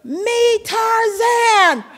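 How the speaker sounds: high shouted jungle-hero cry; Tarzan call. Retold or imitated. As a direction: bellow it like a swinging Tarzan. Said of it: "and she goes".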